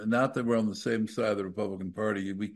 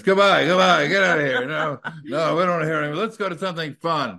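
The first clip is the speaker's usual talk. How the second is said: higher, louder mock brush-off; hurried dismissal; 5 semitones higher, 5 dB louder, faster than usual. Radio host hustling a guest off air, mock hurried dismissal. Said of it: "She wanted to get her off that air as fast as she could".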